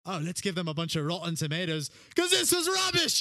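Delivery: mock indignation, rising to a loud finish; mock outraged heckler. Medieval crowd pelting a bad artist, mock outraged. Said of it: "That's what it was like back then".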